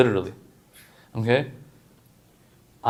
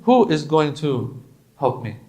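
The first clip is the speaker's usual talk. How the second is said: ordinary talk, nothing marked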